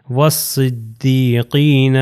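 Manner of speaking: والصدیقین very slowly as "was-siddeeqeena"; والصدیقین drawn out, lam silent; waw joined to saad. Shown as a demonstration. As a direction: recite والصدیقین with doubled heavy s and long "ee". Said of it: "یہ واو صاد سے مل جائے گا".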